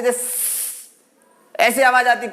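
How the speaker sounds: slow, drawn-out hiss like a cooker whistle. Shown as a demonstration. Teacher imitating a pressure cooker whistle to explain s. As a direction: stretch the s like a whistle starting. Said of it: "जैसे हमारे cooker की सीटी start होती है".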